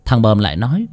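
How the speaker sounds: ordinary delivery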